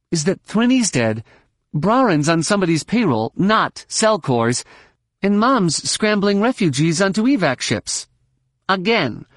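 high-pitched, swooping delivery; exasperated young woman pilot. Acted. Pilot finishes her sketchy story to her companion, exasperated. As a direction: sum up the mess in a high, weary-of-it voice.

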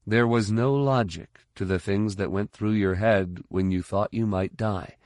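usual speaking voice

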